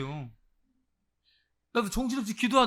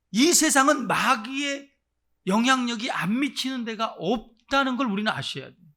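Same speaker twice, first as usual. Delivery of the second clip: ordinary delivery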